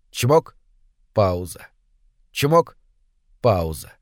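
чмок up, пауза dropped low; rhythmic pump. A narrator's reading. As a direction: mechanical beat: чмок rising, пауза falling deep. Ритмичный звук помпы. (ритмично: «чмок» вверх, «пауза» низко вниз)

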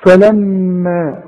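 slow فلما, humming long on the doubled م; held nasal م. Shown as a demonstration. Teacher showing the doubled meem, long hummed م. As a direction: stretch the م of فلما as a nasal hum. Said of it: "غنها بمقدار حركتين".

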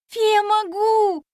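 high, loud and slow with joy; delighted. A narrator's reading. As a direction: greet her with relief. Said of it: "обрадовалась я".

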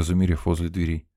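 ordinary delivery

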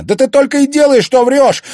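very high, fast, loud accusation; angry shout. A narrator's reading. Son accusing mother of constant lying, shouting. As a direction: yell it straight back at her.

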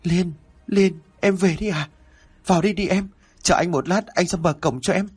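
high, eager call toward the gate; bright hopeful shout of welcome; joyful calling out. A narrator's reading. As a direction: call out to her, overjoyed she's back. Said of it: "tiếng của Hải chợt reo lên".

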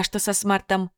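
usual speaking voice

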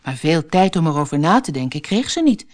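ordinary delivery, nothing marked

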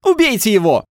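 высокий громкий вопль из толпы; выкрикни высоко, по-женски, требуя расправы; женский крик. A narrator's reading. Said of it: "раздался женский крик". (женский крик)

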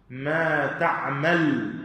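تعمل slowly, ending on a held, vowelless l; ما تعمل drawn out, no vowel after the final lam; sukun on the lam. Shown as a demonstration. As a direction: stop تعمل on a bare l, "ta'mal". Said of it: "اللام عليها السكون".

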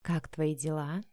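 low, soft and slow; sultry voice. A narrator's reading. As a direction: purr it like a phone flirt. Vika sultry on phone. (томно и медленно)

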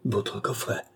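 taken aback, soft and subdued; stammered. A narrator's reading. Police chief caught holding the countess's casket, stammering. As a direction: say it softly, as if caught out. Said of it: "balbutia monsieur de Sartine".

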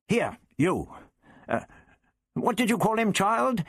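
put-on high, peremptory old man; the Doctor, commanding. The Doctor summons the Zarbi, forgetting its name, imperiously. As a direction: order sharply, then falter into a high question.

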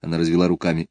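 ordinary delivery

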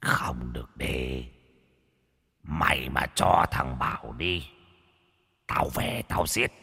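quiet low ghost voice; menacing ghost. Acted. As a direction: hiss the threat like a ghost from the pond. Ghost threatens in nightmare.

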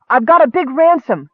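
high, loud desperate yell; panicked high bargaining shout; frightened yell. Acted. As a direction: shout your worth to stay alive. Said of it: "the woman yelled again".

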